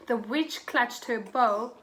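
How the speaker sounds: ordinary delivery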